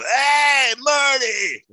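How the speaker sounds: high, loud call across the street; yelled greeting. Retold or imitated. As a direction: shout out like a drunk buddy spotting a friend. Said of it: "one of them yelled out".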